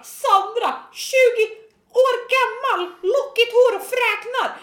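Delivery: high, slow called-out self-description; hammed-up high-pitched declaiming; mock announcement. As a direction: proclaim it like a breathless police bulletin.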